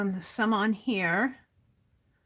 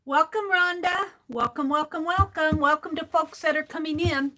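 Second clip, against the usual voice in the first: cheery sing-song greeting; 6 semitones higher than usual. Real, not acted. Greeting viewers joining live.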